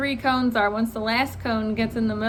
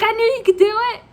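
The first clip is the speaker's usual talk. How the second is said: high, loud, fast cheer; proud dad cheer; 9 semitones higher, 6 dB louder, faster than usual. Retold or imitated. Driving teacher to student who passed, proudly excited. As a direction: burst out with pride in your student. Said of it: "He looks like such a proud dad".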